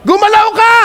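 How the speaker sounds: high, loud rallying shout. Shown as a demonstration. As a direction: belt it out to the crowd. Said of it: "Lakasan niyo! Feel niyo to!".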